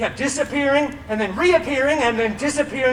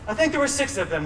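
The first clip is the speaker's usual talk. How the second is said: ordinary talk, nothing marked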